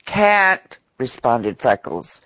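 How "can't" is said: high, louder, mock-terrified; thick with terror. A narrator's reading. Freckles pretending terror to the lobbyist. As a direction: fake the boy's terror, pushed up high. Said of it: "his voice thick with terror".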